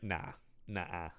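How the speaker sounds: low, soft mock refusal; dismissive Van Helsing. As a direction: drop it low and quiet, unimpressed. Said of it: "Van Helsing's like".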